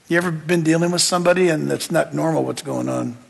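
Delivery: ordinary talk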